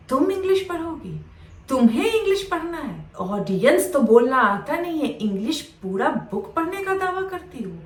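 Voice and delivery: imitating a teacher's incredulous, high-pitched scolding; scornful teacher. Teacher snatches book, humiliates the girl. (अपमान करते teacher की नकल, तिरस्कार भरे सवाल)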